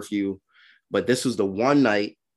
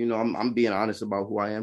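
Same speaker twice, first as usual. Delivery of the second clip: usual speaking voice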